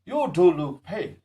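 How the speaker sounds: "do" stressed high and loud in "You do look pale". Shown as a demonstration. Teacher showing how to insist on a statement, emphatic "do". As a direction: lean hard on "do" to insist. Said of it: "to lay emphasis on some".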